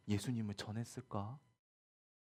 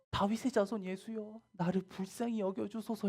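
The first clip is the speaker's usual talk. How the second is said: high-pitched acted appeal; imploring cry; 10 semitones higher than usual. Blind Bartimaeus begging Jesus for mercy, imploring cry. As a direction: call out for mercy, pitched well up.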